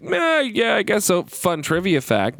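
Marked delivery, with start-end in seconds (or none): 0.0-1.2 reluctant. Retold or imitated. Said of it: "He's like".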